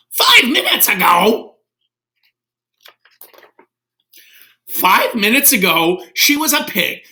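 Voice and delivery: high, loud disbelieving shout; high, loud astonished cry; shocked Gerald. Acted. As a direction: yelp it in disbelief.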